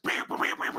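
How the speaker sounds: rapid voiceless pew-pew firework noises; finger-gun pews. Pretend. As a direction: mouth toy gun shots, no voice. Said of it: "Those are my finger guns to go along with fireworks".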